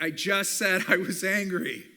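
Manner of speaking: loud, slow, exasperated reply; annoyed retort. Pretend. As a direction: snap back, fed up. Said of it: "they're gonna be like".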